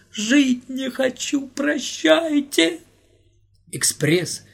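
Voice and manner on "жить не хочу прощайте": high, very slow drawn-out lament; despairing farewell. Acted. Volodya bidding everyone farewell in despair, slow high wail. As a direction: stretch out a hopeless goodbye.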